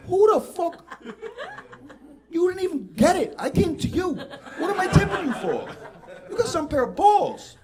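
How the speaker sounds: high, loud incredulous ranting; outraged rant. Real, not acted. Comic ranting at a shop tip request, high loud outrage. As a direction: tear into the tip screen.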